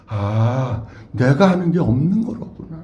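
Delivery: low, softened voice of dawning insight; subdued, reflective quote; humbled realization. Acted. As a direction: voice Moses admitting his helplessness. Voicing old Moses' humbled realization.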